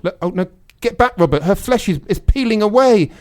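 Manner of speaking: frantic, losing control; panicked. Acted. Panicked scene, melting robot.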